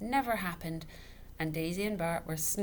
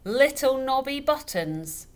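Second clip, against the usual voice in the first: slow, high, loud mocking echo; tipsy father mocking the name; mocking repeat; 8 semitones higher, 11 dB louder, slower than usual. A narrator's reading. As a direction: drag out the name in scorn. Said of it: "repeated Mister Dovetail".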